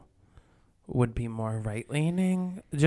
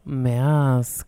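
mask radio voice. Real, not acted. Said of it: "that's my mask radio voice".

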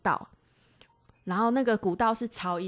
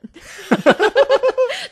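high loud laugh; 12 dB louder than usual. Real, not acted. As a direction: burst of high-pitched laughter. Laughs at own army look. (高声大笑)

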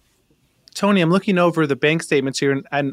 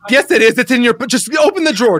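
loud, higher, demanding; angry yelling; 7 semitones higher, 6 dB louder than usual. Acted. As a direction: yell at her. Demanding money angrily.

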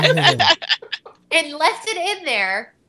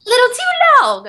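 mock director; 6 dB louder than usual. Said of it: "Kyle's like".

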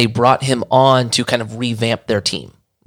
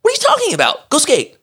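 high, scoffing voice of the company president; hammed-up high voice of an unimpressed boss; mock dismissive boss. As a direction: brush him off like a kid asking something silly. Boss dismisses him as skater.

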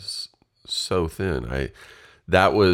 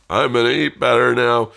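loud, high, over-eager declaration; mock gung-ho resolve; 9 semitones higher, 9 dB louder than usual. Podcaster mocking sudden New Year's Day resolutions, loud gung-ho. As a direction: proclaim it like an instant convert.

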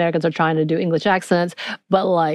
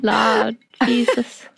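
slow, hammed-up Southern drawl; worst Southern accent; 3 semitones higher than usual. Co-host orders an audition on the spot, mock Southern accent. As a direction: do a deliberately bad Southern accent. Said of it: "worst Southern accent".

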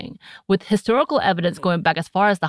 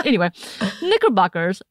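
ordinary delivery

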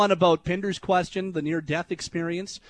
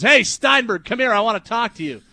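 loud, higher call across the rooftop; calling over; 4 semitones higher, 6 dB louder than usual. Retold or imitated. Drunk dad summoning the host at the wedding, loud call. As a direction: bark his name to summon him.

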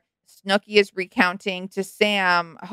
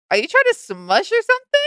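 teasing; 14 semitones higher than usual. Retold or imitated. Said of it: "And she goes".

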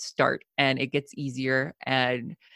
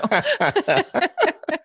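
high, louder laughter; amused laugh; 5 dB louder than usual. Real, not acted. Guest admitting she was kicked out, laughing.

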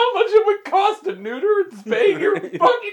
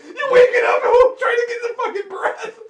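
laughing choking; 6 semitones higher, 4 dB louder than usual. Pretend. Imagined choking on spiders.

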